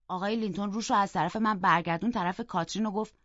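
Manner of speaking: plain reading voice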